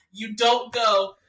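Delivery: ordinary talk, nothing marked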